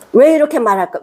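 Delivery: usual speaking voice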